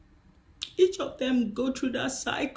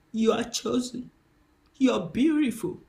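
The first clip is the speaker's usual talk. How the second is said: ordinary delivery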